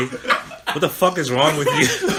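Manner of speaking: laughing mock confrontation. Co-host teasing friend's harsh question, laughing imitation. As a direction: mimic the tough line, cracking up. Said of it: "look at her dead in her face and say".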